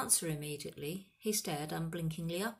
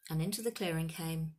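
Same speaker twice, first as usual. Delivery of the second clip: usual speaking voice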